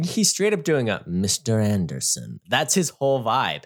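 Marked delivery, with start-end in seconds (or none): 1.1-2.4 slow, low Matrix villain; drawn-out, lower-pitched villain impression; Mister Smith. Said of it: "his best impression of Mister Smith".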